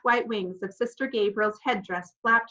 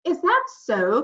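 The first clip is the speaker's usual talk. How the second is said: cool challenge; 6 semitones higher, 6 dB louder, slower than usual. A narrator's reading. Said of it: "as cool as could be".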